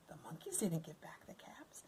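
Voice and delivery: soft whispered narration; story whisper. A narrator's reading. Narrator whispering to the child listener, hushed.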